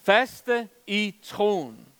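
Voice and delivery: drawn out word by word, "Faste" leaned on; preacher hammering the point slowly; very slow emphasis. Shown as a demonstration. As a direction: stretch "Faste i troen" out very slowly.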